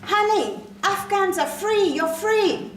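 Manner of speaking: very high, loud jubilant insistence; zealous American stranger. Acted. Woman who followed her to tell her, high zealous shout. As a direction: proclaim the good news at full voice.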